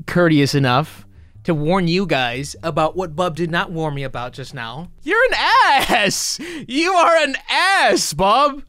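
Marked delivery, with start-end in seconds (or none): 5.0-8.6 very high-pitched, stretched-out outburst; exasperated insult. Real, not acted. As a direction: hurl the insult with the voice shooting up. Insulting the narrator after the scare.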